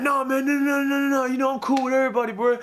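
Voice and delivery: high, fast, hammed-up backpedalling; defensive denial. Gym guy denying he picks on people, fast and high. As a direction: rattle off the no's like he's caught.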